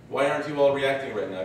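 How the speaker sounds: ordinary talk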